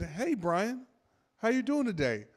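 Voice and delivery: high, soft phone politeness; softly lilting customer-service voice; soothing service rep. Acted. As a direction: gently greet the irate customer. Rep soothing an angry caller.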